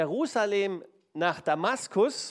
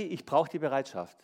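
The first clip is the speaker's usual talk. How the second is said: normal talking voice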